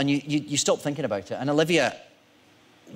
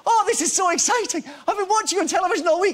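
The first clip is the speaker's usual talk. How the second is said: high, loud imitation of a thrilled old fan; hammed-up starstruck gushing, voice pitched right up; very excited; 5 dB louder than usual. Little old man gushing to TV stars, hammed-up excitement. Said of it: "he starts getting very excited".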